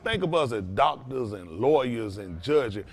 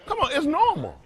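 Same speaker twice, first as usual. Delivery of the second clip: "normal" thrown up much higher in disbelief; exasperated disbelief; 11 semitones higher than usual. Real, not acted. Exasperated, telling the co-host racism is normal. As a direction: throw it back at them, fed up and incredulous.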